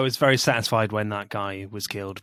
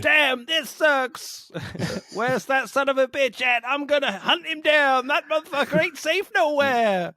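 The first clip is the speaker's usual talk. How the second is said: hammed-up rant pitched far higher; mock angry rant; slower than usual. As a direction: rant like a cartoon tough guy. Mock tough-guy rant.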